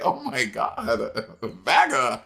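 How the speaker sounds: mock outrage leaping very high. Guest retelling reaction to misheard line, mock outrage. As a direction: gasp it as scandalised comic indignation. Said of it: "I was like".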